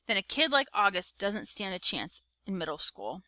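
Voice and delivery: usual speaking voice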